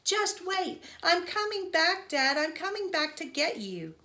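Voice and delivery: high, urgent yelling; frantic high-pitched reassurance, shouted; screamed promise. A narrator's reading. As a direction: call out loudly to someone trapped. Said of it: "I screamed".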